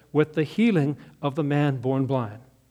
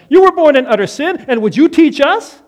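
loud high angry outburst; furious leaders; 8 semitones higher, 9 dB louder than usual. Acted. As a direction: hurl the insult at the man. Leaders get upset, quoted.

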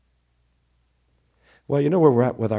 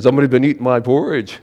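gruff, loud Papa Bear voice; loud, quick Papa Bear complaint; 7 dB louder, faster than usual. Acted. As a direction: boom it out like a big papa bear.

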